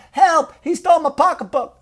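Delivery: high-pitched mock cry for help; movie crime victim. Victim in a cop movie calling for help, mock alarm.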